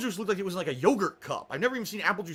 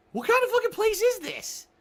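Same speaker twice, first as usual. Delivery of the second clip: much higher, incredulous outrage; outraged; 5 dB louder than usual. Retold or imitated. Reacting to the nurse's scorn, high outraged disbelief.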